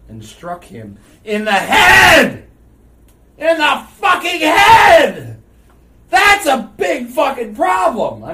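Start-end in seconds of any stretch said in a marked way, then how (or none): 1.2-8.2 slow, loud, high furious yelling; real exasperated shouting about the accident; outraged shout. Real, not acted. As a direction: blow up in disbelief. Outraged at fatal stunt accident.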